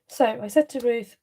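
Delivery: normal talking voice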